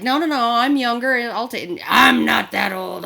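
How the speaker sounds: loud, insistent mock protest; arguing. Retelling argument over carrying the freezer, arguing. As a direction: argue back loudly, imitating a stubborn man.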